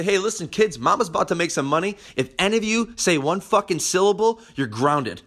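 mock parent warning. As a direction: bark a mock threat at the kids. Parent threatening kids.

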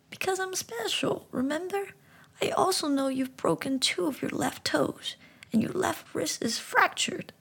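talking frog gently explaining; high frog voice. Acted. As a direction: speak like a wise little frog.

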